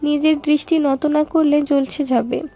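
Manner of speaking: usual speaking voice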